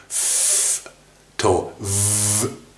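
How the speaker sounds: ふ voiceless, then V buzzed with the same mouth; F versus V. Shown as a demonstration. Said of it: "要するに". (同じ口の形で「ふ」と「V」を言い分ける)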